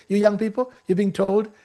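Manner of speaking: normal talking voice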